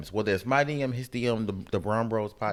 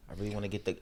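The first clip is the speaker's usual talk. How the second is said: usual speaking voice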